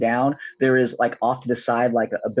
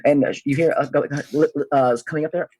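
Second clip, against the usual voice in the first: usual speaking voice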